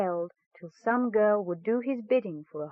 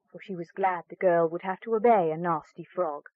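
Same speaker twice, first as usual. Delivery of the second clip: plain reading voice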